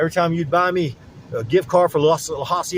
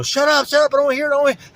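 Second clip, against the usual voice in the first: higher, louder mock yell of a crowd; imitating people cutting him off; shut up; 12 semitones higher, 5 dB louder, much faster than usual. Everyone tells him to stop talking, mock yell. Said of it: "everyone was going".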